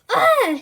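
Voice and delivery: high loud fake scream; startled scream. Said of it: "screamed Darren".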